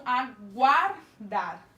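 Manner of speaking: aguardar said slowly with the A held loud; slow teacher demo of the strong A; stretched demo. Shown as a demonstration. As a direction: lean on the A in aguardar. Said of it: "Esse A a gente ouve mais".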